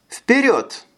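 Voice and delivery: high command call. A narrator's reading. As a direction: call the order out. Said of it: "скомандовала Ая".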